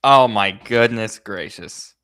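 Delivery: choppy bitcrushed sound; garbled digital line; robotic voice. Real, not acted. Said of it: "you sound very robotic to me".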